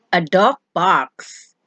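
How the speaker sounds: ordinary delivery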